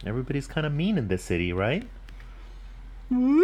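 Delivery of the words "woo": high, long "woo" like a siren; siren wail. Acted. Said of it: "Went a siren".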